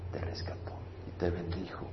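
hushed whisper of awe. Real, not acted. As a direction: breathe it out almost silently. Awed close of sermon thought. (susurro lleno de asombro)

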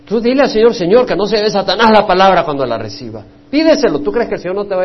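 predicación encendida; fiery preaching. Acted. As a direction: push them to pray, full force. Preaching at full force.

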